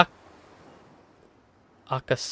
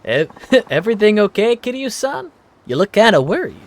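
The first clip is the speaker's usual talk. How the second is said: concerned woman voice; 9 semitones higher, 4 dB louder than usual. Acted. Voicing Miyara, concerned woman.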